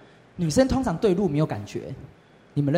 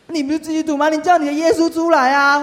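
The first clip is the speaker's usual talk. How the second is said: put-on crying girl's demand; 12 semitones higher, 5 dB louder than usual. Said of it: "就是一直哭".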